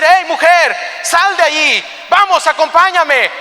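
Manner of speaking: high, loud soldier's command; shouted orders. Acted. Soldiers ordering Achan's wife out of the house, shouting. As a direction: order her out with full force.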